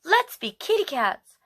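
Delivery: sing-song big sister; playful big sister. Acted. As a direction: coax a baby into a game. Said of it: "I say".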